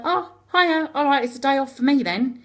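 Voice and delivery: breezy mock greeting. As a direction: act out a casual, carefree hello. Voicing a casual greeting in reported speech.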